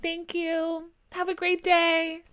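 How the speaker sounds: high, loud sugary politeness; overly polite. Retold or imitated. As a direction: gush like a cheerful customer.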